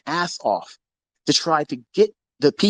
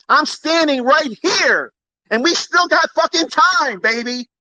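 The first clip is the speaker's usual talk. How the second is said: fired-up taunt; 8 semitones higher than usual. Real, not acted. Boastful challenge to rivals.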